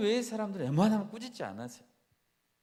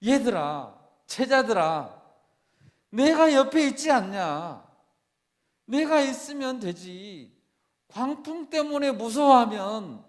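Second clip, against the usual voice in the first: higher, louder, slow scolding; preacher voicing Jesus as a loud, slow scolding; Jesus rebuking; 6 semitones higher, 7 dB louder, slower than usual. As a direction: scold the scared disciples from above. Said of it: "꾸짖는 거예요".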